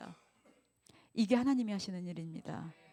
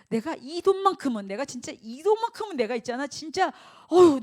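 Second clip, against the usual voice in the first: raised, loud clinging sigh; cling to the money, huffing; 집착하는 흉내; 5 semitones higher, 6 dB louder than usual. Retold or imitated. (돈을 놓지 못하는 사람의 큰 한숨 섞인 흉내)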